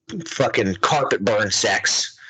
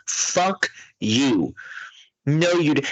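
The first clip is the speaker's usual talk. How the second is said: normal talking voice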